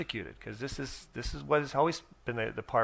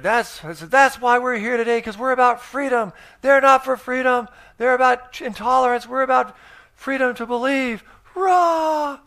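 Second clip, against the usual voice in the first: much higher and louder, a roaring stage rebuttal; fiery rally shout; 12 semitones higher, 10 dB louder than usual. Retold or imitated. Preacher on stage shouting down an activist, roaring. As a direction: shout him down like a crowd rouser. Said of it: "smashed these people into the ground".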